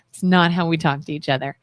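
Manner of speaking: low, quick, softened threat ending in a laugh; mock threat. Wife retelling a warning to her soldier husband, joking low threat.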